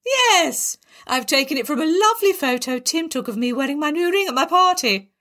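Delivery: high excited Yes; delighted exclaim. A narrator's reading. Barbara proudly reveals her self-portrait, excited. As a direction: gush with pride.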